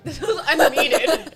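high, loud, slow words through laughter; real laughter stretching out the words; laughing hard. Real, not acted. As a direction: crack up while trying to speak. Cracking up while retelling.

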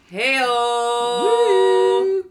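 loud, high cheeky hey-o. Real, not acted. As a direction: belt it like a party cheer. Cheeky sex joke punchline.

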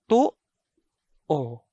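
"to-o," cut off after the first vowel, then a normal o; glottal catch. Shown as a demonstration. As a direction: say "to-o," with a full stop in the throat between the vowels. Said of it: "so it is stopped, while the second vowel is a normal vowel".

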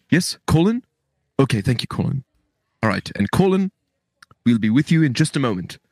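polite receptionist in mock British accent; put-on British accent. Call screener putting Colin on hold, in put-on British accent. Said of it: "maybe I could do a better accent".

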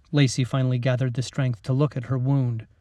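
ordinary delivery

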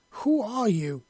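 high loud demand; yelling. Acted. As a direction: yell it at the empty forest.

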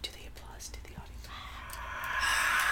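hushed unvoiced aside; whispering. Real, not acted. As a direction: whisper it offstage.

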